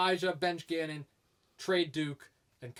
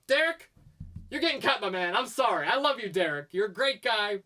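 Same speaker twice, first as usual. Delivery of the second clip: loud, higher mock announcement; calling out; 4 semitones higher, 4 dB louder than usual. Podcaster jokingly cutting Derek from his list, calling out. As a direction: break the bad news with playful volume.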